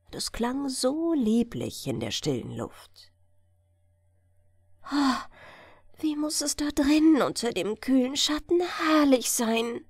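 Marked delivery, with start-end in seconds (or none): 4.8-9.9 put-on higher, longing young girl; a child wondering at the magic island; girl's dreamy voice. A narrator's reading. Girl Hella dreaming aloud in the boat, higher longing voice. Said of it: "dachte Hella".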